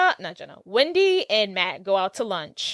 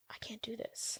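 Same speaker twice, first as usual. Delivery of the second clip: tiny whispered mock panic; barely audible imitation of cold feet; whispered dread; 21 dB quieter than usual. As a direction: whisper it as a private realization. Acts his reaction to marriage talk.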